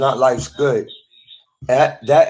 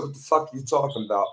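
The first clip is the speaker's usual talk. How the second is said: much softer, quick reply; retold baffled retort; 8 dB quieter, much faster than usual. Speaker recounting their reply to hospital evaluator, quick hushed retort.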